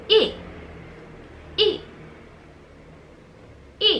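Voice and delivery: E dropped hard from high, angry fourth tone; sharp falling fourth tone. Shown as a demonstration. Teacher voicing the angry falling fourth tone. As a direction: snap E downward like you're mad. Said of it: "Fourth tone is the angry tone".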